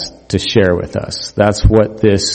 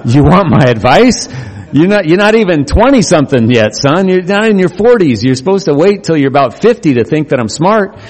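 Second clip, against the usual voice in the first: joking father; 7 semitones higher than usual. Retold or imitated. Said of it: "I just about fell out of my chair".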